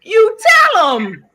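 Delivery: high and loud, no doubt about it; emphatic exclaim. Real, not acted. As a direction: blurt it out with total conviction. Emphatic answer to the question.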